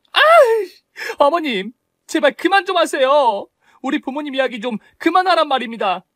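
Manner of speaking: daughter-in-law crying out for the mother-in-law to stop; exasperated outcry. Acted.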